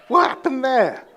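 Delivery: loud, high burst of bafflement; incredulous. Retold or imitated. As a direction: react aghast for comic effect. Inner reaction to wife's posh voice.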